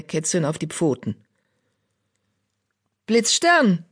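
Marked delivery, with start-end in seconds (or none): 3.1-3.8 a clear call across the clearing, higher. A narrator's reading. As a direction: raise the voice and call his name. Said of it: "rief sie".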